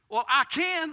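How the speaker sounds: high, loud retort; defiant witch. Retold or imitated. Witch contradicting the pastor, high and loud. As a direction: argue back at full pitch.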